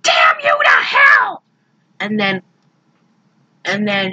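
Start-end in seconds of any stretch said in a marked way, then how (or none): 0.0-1.4 furious shout. Retold or imitated. Carla screaming at Michael for hiding the shark, furious shout. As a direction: scream it at him like he betrayed you.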